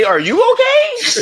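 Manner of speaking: exaggerated mock panic; hammed-up concern. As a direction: overdo the fake worry, squeal it.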